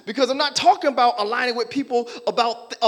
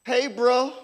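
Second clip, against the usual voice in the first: ordinary talk, nothing marked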